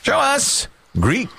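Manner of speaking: high, drawn-out board call; game-show reveal call. Pretend. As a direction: stretch it out like a host's reveal. Host calls answer to board.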